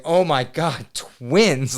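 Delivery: amazed exclamation; stunned amazement. Retold or imitated. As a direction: relive the shock with a laugh. Acting out his stunned reaction.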